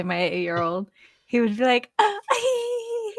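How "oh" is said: high, tiny bashful squeal; impression of shy child. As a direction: squeak shyly like a blushing little boy. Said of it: "that's your impression of him".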